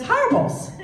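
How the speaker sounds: put-on high, loud Spanish-accented herbals. [name] imitating Luis with a Spanish accent. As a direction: mimic Luis's accent, laughing. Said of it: "And Luis says".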